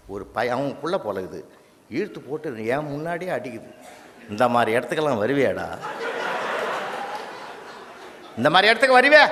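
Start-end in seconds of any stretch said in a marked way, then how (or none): 4.3-9.2 angry mother. Retold or imitated. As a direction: scold the boy publicly in a rising yell. Mother scolding son at venue.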